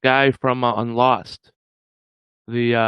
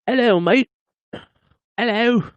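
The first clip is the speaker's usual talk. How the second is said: high-pitched hammed-up British greeting; mock British accent; 11 semitones higher than usual. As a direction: put on a silly British accent, rising at the end. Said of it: "you have an accent. You're like".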